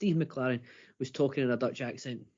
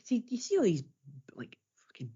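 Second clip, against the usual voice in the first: whisper; 5 semitones higher than usual. Real, not acted. Conspiratorial aside about polls.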